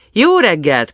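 high, loud call that falls away; cheery called-out hello; hearty greeting. A narrator's reading. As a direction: hail the gatekeeper brightly from the coach. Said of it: "köszöntötték a kapuőrét".

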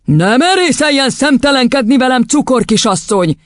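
high, outraged rebuke; snapping. A narrator's reading. Headmistress to the cheeky teacher, snapping. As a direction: snap back at insolence. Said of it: "csattant fel az igazgatónő".

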